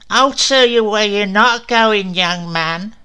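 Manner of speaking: high, loud spitting crone; old witch jeers at Ray; cackling witch. Acted. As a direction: spit it out like a fairy-tale witch. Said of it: "cackling and spitting".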